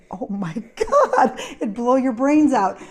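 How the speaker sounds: loud, high, amused exclamation; laughing exasperation. Retold or imitated. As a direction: burst out laughing in mock horror. Exasperated reaction to blaring TV, laughing.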